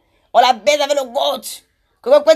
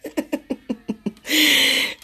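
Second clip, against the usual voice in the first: real laughter in quick bursts; 4 dB quieter than usual. Real, not acted. Laughing between mocking insults, real laugh. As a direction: laugh out in a staccato run.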